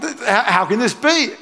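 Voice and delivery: high, stuttering bewilderment; baffled Mary. Acted. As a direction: stammer the question in comic disbelief. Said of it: "Mary's like".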